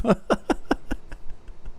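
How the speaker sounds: quiet, breathy chuckling; helpless soft laugh. Real, not acted. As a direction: crack up before the punchline. Laughs at own story.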